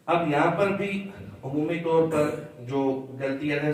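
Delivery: ordinary delivery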